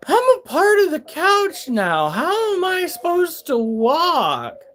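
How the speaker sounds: high, slow dazed whine; a high, drawn-out stoned complaint. Retold or imitated. Stoned self voiced high.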